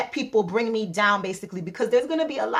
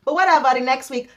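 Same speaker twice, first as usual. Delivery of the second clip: ordinary talk, nothing marked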